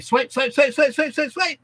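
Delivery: high, quick run of identical beats; high-pitched repetitive patter imitating swiping; mimicked rapid swiping. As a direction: rattle it off high, like repeated finger swipes. Mimics rapid swiping through videos.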